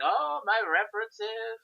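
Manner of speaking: imitating a light French accent, slow; a hint of Rigadon's French accent, drawn out. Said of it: "Cam Clark with his French accent".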